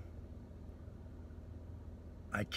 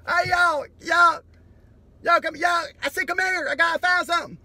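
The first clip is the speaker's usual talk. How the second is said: much higher, louder hammed-up shouting; future species shout; 15 dB louder than usual. Future species finding human remains, high excited shouts. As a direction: call the whole crew over, yelling high. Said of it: "and be like".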